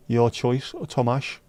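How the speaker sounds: usual speaking voice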